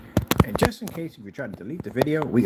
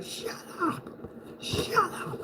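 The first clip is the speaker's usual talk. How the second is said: very high hushed hiss; wife's hiss; 10 dB quieter, much slower than usual. Retold or imitated. Wife hushing her husband in a high hiss. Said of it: "his wife is like".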